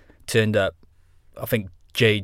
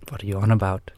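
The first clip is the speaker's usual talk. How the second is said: put-on Sven accent at his usual pitch. Guest stays in character as his alter ego, put-on accent. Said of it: "putting on the fucking accent".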